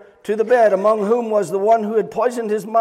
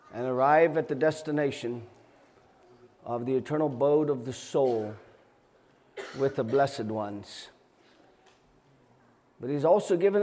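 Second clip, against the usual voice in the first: soft earnest preaching; 5 semitones lower, 6 dB quieter than usual. Acted. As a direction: plead softly with the congregation. Quiet earnest appeal to listeners.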